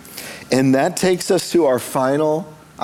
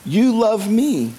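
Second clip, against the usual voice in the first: higher, slow and drawn out, amused; mock-romantic recital; 7 semitones higher, slower than usual. Preacher joking about burrito love, high slow mock-romantic recital. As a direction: stretch out each phrase of the love-song title.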